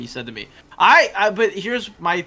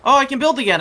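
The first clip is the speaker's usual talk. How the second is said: higher, louder happy burst; excited; 10 semitones higher, 11 dB louder, faster than usual. Real, not acted. Player thrilled she can build again. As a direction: cheer as the build option returns.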